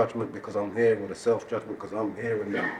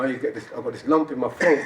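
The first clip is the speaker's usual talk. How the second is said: ordinary talk, nothing marked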